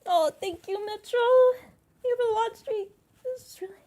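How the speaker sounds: high-pitched, tearful thanks; happy tears. Real, not acted. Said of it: "it's happy tears".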